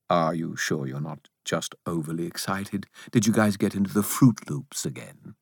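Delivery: quick, lightly voiced know-it-all spirit; hint of a patient, doubting character; sceptical Bob. A narrator's reading. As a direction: dismiss the worry briskly, then question it. Bob reassures the narrator.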